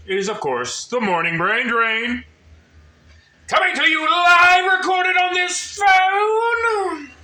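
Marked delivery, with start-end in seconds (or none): 3.5-7.1 loud, high, slow announcer yell; shouting announcer. Acted. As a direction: bellow it like a live broadcast.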